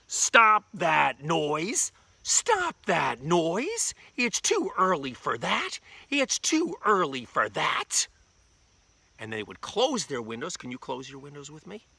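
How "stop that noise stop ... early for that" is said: loud, stretched-out indignant holler; hollering townspeople. Acted. Townspeople woken too early to the boy, hollering. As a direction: call it out slowly and raised in pitch. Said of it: "they'd holler".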